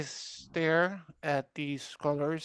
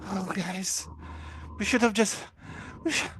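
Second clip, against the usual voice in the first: trembling voice; 4 semitones higher, faster than usual. Acted. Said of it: "this voice is trembling".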